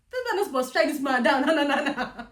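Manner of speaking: high, loud, rapid protest; reliving an angry rant at God; angry outburst. Retold or imitated. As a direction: rush it out loudly, indignant. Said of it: "I was so angry".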